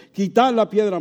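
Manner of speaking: firm command. Acted. Preacher voicing Jesus ordering the stone moved, firm command. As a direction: call the order out to the crowd.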